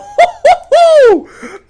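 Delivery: high, loud cackling laugh; burst of laughter. Real, not acted. Gamer cackling loudly at his own play. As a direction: let a big laugh burst out.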